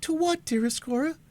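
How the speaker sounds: put-on high, pressing young voice; eager cry. A narrator's reading. Alice urging Cora to go on, high and anxious. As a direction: urge her on, high and anxious.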